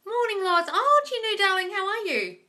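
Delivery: high, loud, delighted hello; gushing greeting. Real, not acted.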